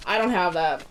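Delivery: usual speaking voice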